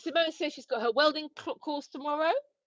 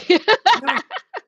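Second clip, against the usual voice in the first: loud high cackle; loud laugh; 6 semitones higher, 11 dB louder than usual. Real, not acted. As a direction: burst out laughing. Laughing after innuendo.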